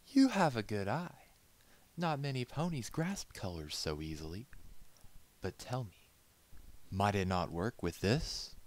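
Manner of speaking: high, bright lady's praise; charmed and teasing; delighted lady. Acted. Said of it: "She laughed".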